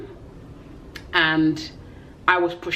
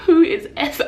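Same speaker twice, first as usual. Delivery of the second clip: high, loud puzzled question; baffled, as if the name means nothing; dazed new mother, totally lost; confused; 11 semitones higher, 5 dB louder than usual. Retold or imitated. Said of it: "I was so confused".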